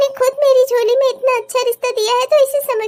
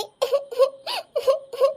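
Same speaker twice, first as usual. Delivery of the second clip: choked sob; 7 dB quieter than usual. Acted. Mother breaks down.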